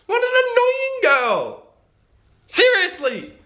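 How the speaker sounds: very high put-on outburst; mock exasperation. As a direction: squeal in pretend outrage. Mock outburst while teasing her.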